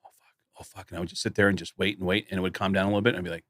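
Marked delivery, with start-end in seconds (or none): 0.0-0.9 breathless whispered oh fuck; panicked whisper. Retold or imitated. Alone on coke, whispering in panic.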